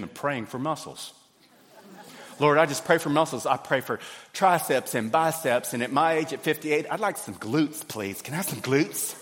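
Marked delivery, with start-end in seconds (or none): 2.4-3.5 loud mock-earnest prayer for muscles; gym-goer loudly praying for muscles. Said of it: "in the middle of the gym going". 3.5-9.2 loud, hammed-up pleading prayer; gym-goer pleading with God for glutes; mock prayer. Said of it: "in the middle of the gym going".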